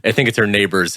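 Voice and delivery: ordinary talk, nothing marked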